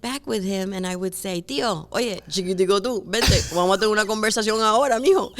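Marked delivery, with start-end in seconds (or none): 1.4-5.3 loud, high, fast Cuban Spanish; boisterous put-on Cuban Spanish; Cuban accent. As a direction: imitate a Cuban uncle's accent, loud and rapid. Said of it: "could actually talk like my uncle".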